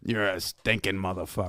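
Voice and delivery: lightly voiced dad's insult; mild imitation of a cursing trainer dad; a hint of Marty. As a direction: toss off the father's curse with a touch of his rasp. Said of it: "he would say like this".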